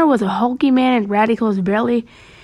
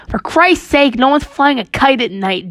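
higher, raised-voice protest; exasperated shout; 5 semitones higher, 3 dB louder than usual. Acted. Douglas scolding the ghost boy, exasperated shout. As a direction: shout it with the pitch pushed up. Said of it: "Douglas shouted".

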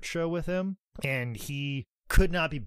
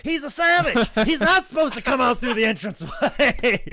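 much higher, louder, delighted voice; imitating George; 11 semitones higher, 8 dB louder than usual. George loving Kamala's unplanned ring entrance, high gleeful voice. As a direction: crow it like a thrilled promoter. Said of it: "He goes".

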